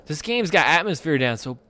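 ordinary talk, nothing marked

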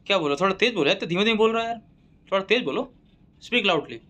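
shouting over his own earphones; loud shout. Acted. Doctor wearing earphones yells at the patient. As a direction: yell at him to speak up.

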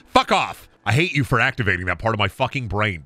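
ordinary talk

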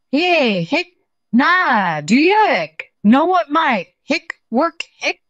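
high, loud words broken by hiccups; desperate, hammed-up hiccup fit; hiccuping tiger. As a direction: keep getting interrupted by exaggerated hiccups. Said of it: "carried on having the hiccup".

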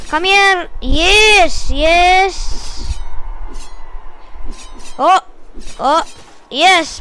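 high, drawn-out triumphant yes; excited cheering. Real, not acted. Cheering on an attack in game.

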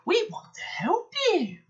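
very high, slow, wheedling voice; mischievous high singsong; sly trucks. A narrator's reading. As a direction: squeak out false helpfulness slowly. Troublesome trucks trick the twins.

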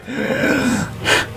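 sharp breathless intake of air; sharp gasp. Real, not acted. Gamer bracing for the reveal, sharp gasp.

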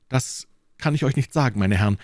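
usual speaking voice

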